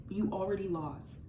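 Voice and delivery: usual speaking voice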